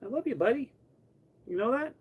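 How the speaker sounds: high, tender cooing; tender pet talk. Real, not acted. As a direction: coo love to the cat. Cuddling the cat.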